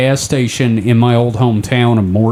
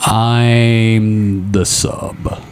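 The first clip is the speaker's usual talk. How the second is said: coy drawn-out reply; 3 semitones lower, much slower than usual. Pretend. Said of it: "And I'm like".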